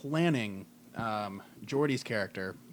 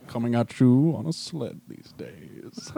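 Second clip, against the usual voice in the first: Orson Welles; 2 semitones lower, 6 dB louder, slower than usual. Retold or imitated. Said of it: "Orson Welles".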